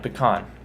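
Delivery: "pecan" with an ah in the last syllable; ah sound. Shown as a demonstration. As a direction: say "pecan" as puh-KAHN. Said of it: "make the ah sound with the A".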